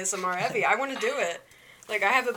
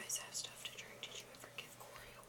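hushed urgent whisper; whisper yelling; 23 dB quieter than usual. Real, not acted. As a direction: whisper it so the mics miss it. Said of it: "Why are you whispering?".

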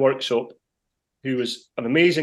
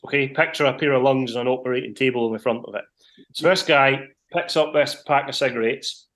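normal talking voice

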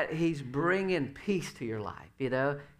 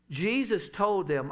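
ordinary talk

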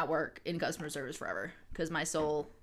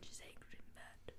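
soft unvoiced whisper; 20 dB quieter than usual. Real, not acted. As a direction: breathe it as an aside. Whispered aside.

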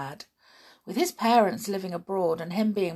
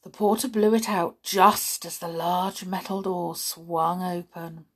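ordinary delivery, nothing marked

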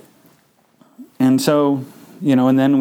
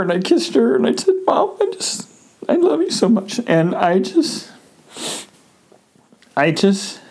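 high, tearful, breaking voice, slowing at the end; real tears welling up as she speaks; crying; 4 semitones higher than usual. Real, not acted. Grieving mother's death, crying again.

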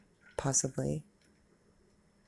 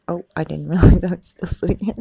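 laughing aside; 11 dB louder, faster than usual. Real, not acted. Laughs at herself.

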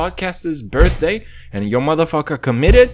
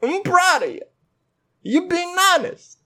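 higher, hammed-up thank you; mock gratitude; 12 semitones higher than usual. Podcaster mockingly thanking his brother for honesty, high sarcastic voice.

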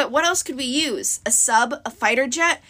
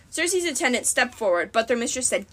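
usual speaking voice

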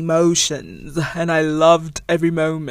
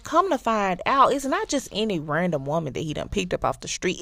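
ordinary talk

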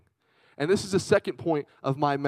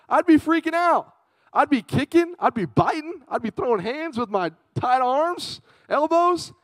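high, loud, worked-up rant; mock freak-out; 10 semitones higher, 4 dB louder than usual. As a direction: get comically frantic at the thought. Imagines himself hogtied, freaking out.

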